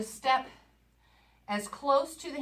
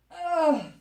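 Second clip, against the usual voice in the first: louder, higher groan of exertion; effort groan; 6 semitones higher, 5 dB louder than usual. Real, not acted. Coach straining as the countdown ends, effort groan. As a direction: groan through the last seconds of the exercise. Straining through last seconds of exercise.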